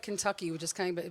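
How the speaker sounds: ordinary talk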